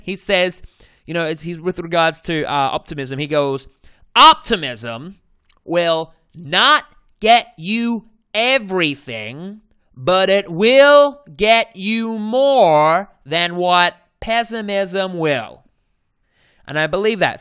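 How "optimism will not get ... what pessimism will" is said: slow, loud Southern accent impression of Zig Ziglar. Coach quoting Zig Ziglar on optimism, slow loud Southern accent. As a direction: stretch it out in a Texan Southern accent. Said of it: "I think he's Texan or Southern accent".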